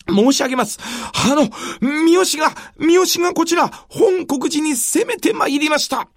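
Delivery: breathless alarmed report; urgent messenger. Acted.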